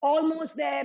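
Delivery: strained mid push-up; straining under load. Real, not acted. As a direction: push through burning arms. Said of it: "my arms are burning".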